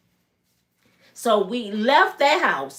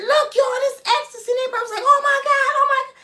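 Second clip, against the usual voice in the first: fan screaming; faster than usual. Retold or imitated. The girls spotting [name] and everybody screaming, high squeals.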